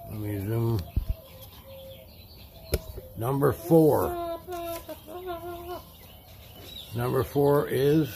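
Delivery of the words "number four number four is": suspenseful slow reveal. Real, not acted. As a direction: stretch out the big number slowly. Drawing out the winner reveal.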